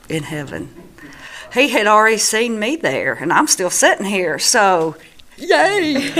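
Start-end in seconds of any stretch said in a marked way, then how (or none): 5.4-6.1 high-pitched little yay; light, high celebratory squeal; playful cheer. Real, not acted. Celebrates that she will be there.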